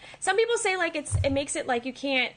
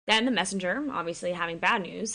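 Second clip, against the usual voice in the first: ordinary talk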